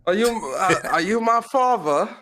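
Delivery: put-on high, pleading boy voice; young Atreus voice. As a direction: plead like a lost kid. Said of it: "Tom Holland as Atreus".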